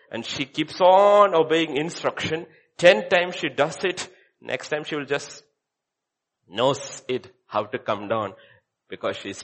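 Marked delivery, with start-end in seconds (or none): none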